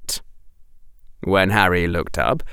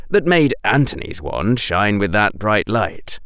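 ordinary delivery

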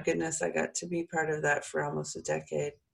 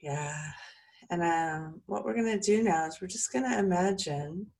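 calm soothing voice; 2 semitones higher than usual. Acted. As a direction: ease them into calm. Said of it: "let my voice calm you and soothe you".

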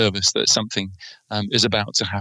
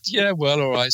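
ordinary talk

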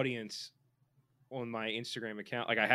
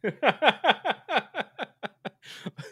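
high, loud rapid cackle; long helpless giggle tailing off; real laughing fit; 13 semitones higher, 8 dB louder than usual. Real, not acted. Host cracking up at own voices, real laughing fit.

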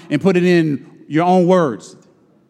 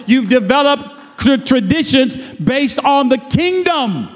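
higher preaching voice; earnest preacher's build; rising sermon; 7 semitones higher than usual. Acted. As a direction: build the point to the congregation.

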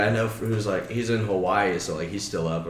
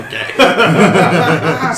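laughing okay; 9 semitones higher, 12 dB louder than usual. Retold or imitated. Resigned reply, laughing.

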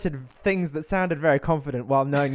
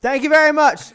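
loud, high imitated shout; Jordan's yell; 11 semitones higher, 10 dB louder than usual. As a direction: holler it like a catchphrase. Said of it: "when Jordan yells out".

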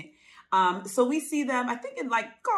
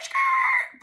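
a very high skirt like screeching brakes; tire screech; 4 dB louder than usual. Retold or imitated. Cohost miming the golf cart U-turn, high screech. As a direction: squeal it like a car stopping short.

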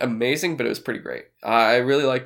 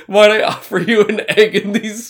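quoting Vito; 7 semitones higher, 6 dB louder than usual. Retold or imitated. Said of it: "And then Vito's like".

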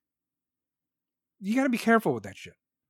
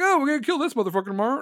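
fast, high, louder mock hothead; a quick, raised mock of someone planning violence; mock quote; 8 semitones higher, 4 dB louder, much faster than usual. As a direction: rattle it off like an eager hothead. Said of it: "Be like".

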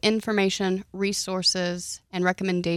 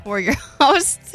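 laughing through the words; 6 semitones higher, 8 dB louder, slower than usual. Real, not acted. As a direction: giggle while you say it. Laughs mid intro.

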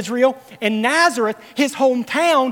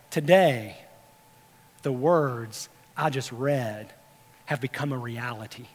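solemn pronouncement; 10 semitones lower, 8 dB quieter than usual. Acted. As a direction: let each phrase sink quietly into the silence. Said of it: "and he says".

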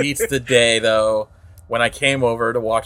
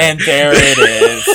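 slow and louder, laughing through the words; 6 dB louder, slower than usual. Real, not acted. Cohost repeats his tease mid-laugh, laughing. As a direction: crack up while teasing.

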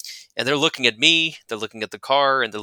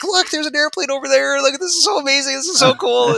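put-on much higher, amazed kid voice pointing at an airplane; excited kids; 6 dB louder than usual. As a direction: play a thrilled child in the back seat. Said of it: "doing this mime".

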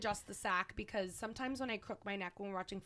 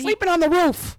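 high, loud mock verdict; hammed-up shouted punishment; mock banishing shout; 8 semitones higher, 16 dB louder than usual. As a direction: yell the pretend sentence out. Continues mock banishment of husband.

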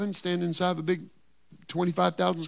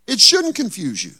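usual speaking voice

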